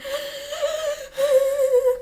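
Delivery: long, very high mock wail; imitated trademark cry. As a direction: mimic the sister's cry in drawn-out high squeals. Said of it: "Her sister cries the exact same way".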